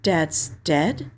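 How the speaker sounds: plain reading voice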